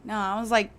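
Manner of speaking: normal talking voice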